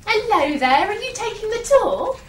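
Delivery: ordinary talk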